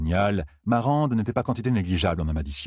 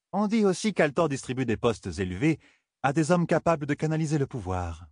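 higher-pitched lady-in-waiting passing on rumours; scheming lady's higher voice; needling courtier; 7 semitones higher than usual. Acted. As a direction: pitch it up, feeding the queen troubling gossip.

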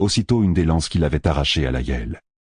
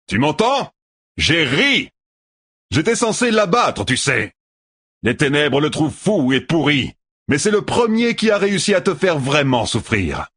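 shouting; 10 semitones higher than usual. Acted. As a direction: jeer at him with all your voice. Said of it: "cria Tueur".

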